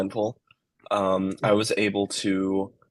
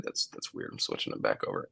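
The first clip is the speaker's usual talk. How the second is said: under-the-breath whisper; muttering aside; 12 semitones lower, 10 dB quieter than usual. Real, not acted. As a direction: mutter it to yourself. Muttered aside while fixing audio.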